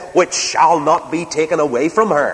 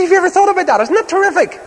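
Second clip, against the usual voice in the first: much higher, bursting with enthusiasm; lightly voiced old friend marvelling; eager friend; faster than usual. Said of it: "he was waxing eloquent".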